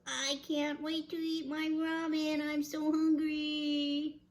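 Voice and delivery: high-pitched eager little bear; Baby Bear. Acted. Baby Bear coming home hungry, eager whine. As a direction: squeal it high, stretching the end.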